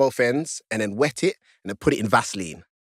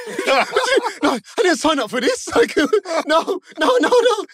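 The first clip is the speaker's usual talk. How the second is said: high, loud, laughing no-no-no; mock horrified refusal; 4 dB louder than usual. Inner panic when mate offers to show him, high laughing protest. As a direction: squeal it out in laughing disbelief. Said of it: "now I'm thinking in my head".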